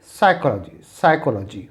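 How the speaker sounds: psychology said as sychology, no p; silent p demo. Shown as a demonstration. Teacher showing students the silent p in psychology. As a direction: start psychology on s. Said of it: "it will be".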